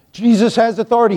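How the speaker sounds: Jesus has authority tossed off quickly; quick restatement. Shown as a demonstration. Repeats the phrase twice, differently.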